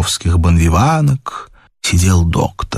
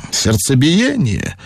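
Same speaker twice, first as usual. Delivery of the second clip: slow, drawn-out doctor's query; idle question; 5 semitones higher, slower than usual. A narrator's reading. Doctor asks the lady for want of anything to do, idly. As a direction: ask it slowly, for want of anything better. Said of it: "спросил от нечего делать доктор".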